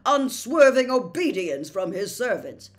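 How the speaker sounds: Percy defending his boss, loud and self-important; pompous official. A narrator's reading. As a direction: lecture her on proper order.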